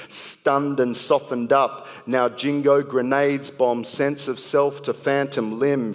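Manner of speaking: rhythmic slam poetry delivery with pointed pauses; poem recited to a live crowd with rising punch; spoken word poem. Acted. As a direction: perform it like a slam poet building to a sting.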